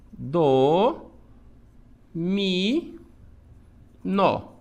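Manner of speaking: dominó split into do, mi, nó with long pauses; separate, stretched syllables, one at a time. Shown as a demonstration. Teacher dividing dominó for the class, syllable by syllable.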